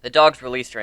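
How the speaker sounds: plain reading voice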